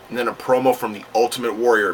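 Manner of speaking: ordinary talk, nothing marked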